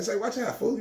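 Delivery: high, quick warning to a friend; warning a friend pointing a gun at him; mock warning.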